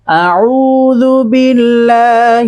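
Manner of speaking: slow, chanted Quran recitation. Acted. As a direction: intone it with tajwid. Recites before the lesson. (তিলাওয়াতের সুরে পড়া)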